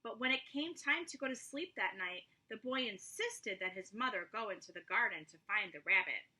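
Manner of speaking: ordinary delivery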